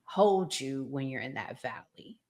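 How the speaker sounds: ordinary talk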